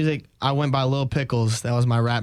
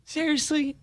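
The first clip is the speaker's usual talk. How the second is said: high, amazed mock question; surprised imitation; 14 semitones higher, 4 dB quieter, slower than usual. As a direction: squeak it out in disbelief. Imitating janitor's surprised reply.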